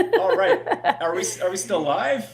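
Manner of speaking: loud laughing call; yelling across the room. Real, not acted. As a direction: shout the question up the stairs. Calling back across the house.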